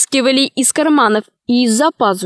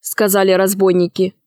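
ordinary delivery, nothing marked

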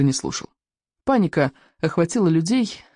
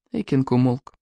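ordinary delivery

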